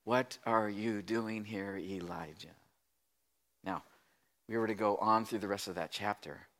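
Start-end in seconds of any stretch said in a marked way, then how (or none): 0.0-2.5 slow, low, hushed question; gentle whisper. Acted. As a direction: ask it softly and slowly, fading on the name. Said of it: "a sound of a gentle whisper".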